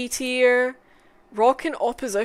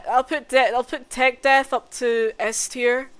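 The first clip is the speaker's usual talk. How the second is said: usual speaking voice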